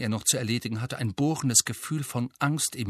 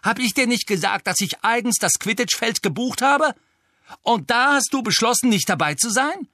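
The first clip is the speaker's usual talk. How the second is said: much higher and louder, building to a shrill peak; furious scolding; 10 semitones higher, 5 dB louder than usual. A narrator's reading. Angelina berating Harry for missing tryouts, building fury. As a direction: tear into him, rising with each question.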